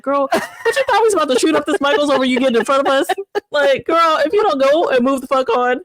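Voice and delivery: hammed-up irritated rant; mock exasperation. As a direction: let her have it, half joking. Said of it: "I was so mad".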